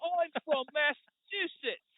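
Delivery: very high, hammed-up British accent failing at Boston; Jason Statham accent. Podcaster mocking a British actor playing a Bostonian, exaggerated British accent. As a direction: overdo the British accent while claiming to be American. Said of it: "Jason Statham playing someone from Boston is like".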